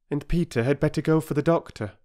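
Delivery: high, soothing mother's voice; mother calming the station master; reassuring mother. Acted.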